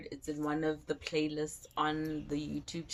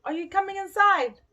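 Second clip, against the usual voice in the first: calling out; 14 semitones higher, 9 dB louder than usual. Real, not acted. Calling to someone off camera.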